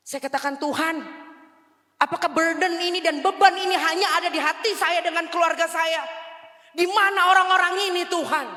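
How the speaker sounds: loud, high tearful outcry; wailing prayer at full voice; anguished cry to God. Retold or imitated. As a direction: cry out to heaven in desperate protest. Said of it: "Nangis. Setiap hari hanya bisa nangis".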